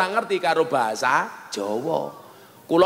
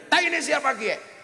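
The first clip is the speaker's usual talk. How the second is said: sudden higher mock yell of disgust; mock shout; 10 semitones higher, 3 dB quieter than usual. Banyumas man stepping in dung, mock higher yell. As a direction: yelp it as if stepping in dirt. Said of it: "Teriak dia".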